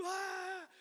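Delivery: high drawn-out mock sob; imitated wail. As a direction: wail thinly, high and long. Imitating the woman wailing on floor. (choro agudo imitado)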